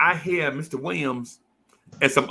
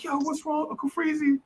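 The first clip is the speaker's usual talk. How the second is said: high, soft concern; caring little girl; 13 semitones higher, 7 dB quieter than usual. Retold or imitated. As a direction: ask gently like a little girl comforting a friend. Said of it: "She like".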